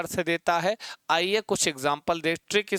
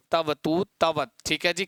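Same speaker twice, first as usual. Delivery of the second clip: ordinary talk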